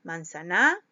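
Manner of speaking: "Manzana" said as "man-za-NÁ", rising at the end; stress on last syllable. Shown as a demonstration. Teacher trying out stresses for pupils, last syllable hit in "Manzana". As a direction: wrongly punch the final "na" of "Manzana". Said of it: "jugando con la intensidad de la voz".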